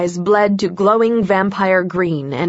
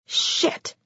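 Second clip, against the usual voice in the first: breathy whispered shit; a soft unvoiced swear; whispered curse; 8 dB quieter than usual. A narrator's reading. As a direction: curse it under your breath. Narrator curses under breath.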